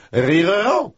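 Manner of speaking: high, trilled "rire"; rolled r. Acted. Translator finishing a warm-up, trilled high "rire". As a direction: roll the r's in "rire" with a flourish. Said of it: "my rolls down".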